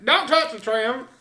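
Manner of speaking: loud, high hammed-up hillbilly holler; Southern accent. Joking about drunk Southern accents.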